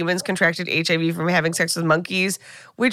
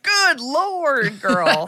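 high, far-off voice of a startled nurse; shocked nurse; 6 semitones higher, much slower than usual. Retold or imitated. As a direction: play the nurse gasping at the rash. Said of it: "she's like".

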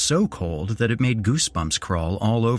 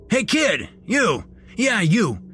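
very high, irate manager's holler; irate manager hailing a kid; angry calling out; 12 semitones higher than usual. A narrator's reading. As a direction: demand an answer in a high, urgent yell. Manager calls out to the boy.

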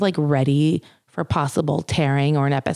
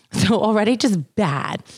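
usual speaking voice

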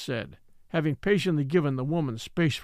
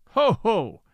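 hearty mock laugh; 6 semitones higher, 5 dB louder than usual. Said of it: "He laughs strong".

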